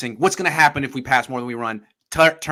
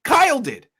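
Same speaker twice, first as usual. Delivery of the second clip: high, loud emphatic protest; excited protest; 7 dB louder than usual. Real, not acted. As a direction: shout it out in disbelief. Emphatic disagreement about Titans.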